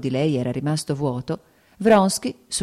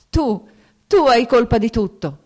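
higher, louder accusing shout; desperate tearful cry; 7 semitones higher, 6 dB louder than usual. A narrator's reading. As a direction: hurl the blame at him in despair. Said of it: "Ella gridò con lacrime di disperazione". (grido disperato, più alto e più forte)

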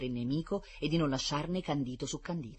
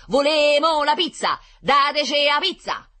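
high, loud Roman-dialect chant; shouting in dialect; 13 semitones higher, 11 dB louder, slower than usual. A narrator's reading. Kids of Trullo besieging the cake, shouting in dialect. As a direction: yell it slowly in Roman street dialect. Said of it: "gridavano in dialetto".

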